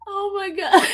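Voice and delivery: helplessly cracked up; crying with laughter. Real, not acted. Streamer reacting to the chat, crying with laughter. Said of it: "They got me crying over here".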